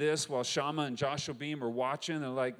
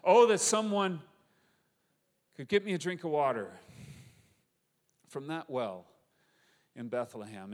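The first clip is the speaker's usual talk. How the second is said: wistful sigh, voice rising then fading away; sighed longing; 2 semitones higher, 5 dB louder than usual. Retold or imitated. David sighing to himself in the cave, longing. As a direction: sigh it out like a weary homesick man. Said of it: "he just sighs".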